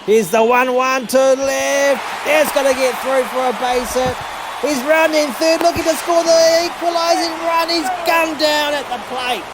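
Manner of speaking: breathless live commentary as the runner is gunned down; excited play-by-play call. Real, not acted. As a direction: ride the play all the way to the plate.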